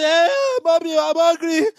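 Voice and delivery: put-on high whiny child begging mommy; hungry child. A child whining to mommy for food. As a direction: play the little child tugging at mother. Child voiced crying to mother.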